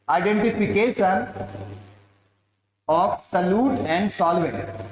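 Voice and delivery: very slow heading dictation; stretched, measured words for note-takers. Real, not acted. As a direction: dictate slowly with gaps for writing. Dictates heading for students to write.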